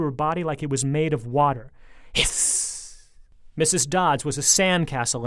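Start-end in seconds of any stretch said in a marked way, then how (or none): 2.1-3.1 hiss. A narrator's reading. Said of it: "monster dissolving".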